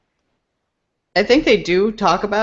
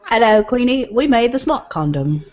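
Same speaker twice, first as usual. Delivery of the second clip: put-on proper British accent; 5 semitones higher than usual. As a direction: greet the Queen in a confident British accent. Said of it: "Now that was a British accent".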